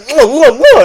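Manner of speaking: loud, high smacking eating noises. Retold or imitated. Mimicking the family eating octopus, loud lip-smacking. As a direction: smack the lips like someone relishing food.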